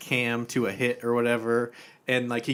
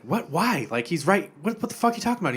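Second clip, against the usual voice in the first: higher, incredulous retort; baffled, pitch climbing on the question; mock bafflement; 7 semitones higher, faster than usual. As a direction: act out exasperated disbelief. [name] baffled by the caller.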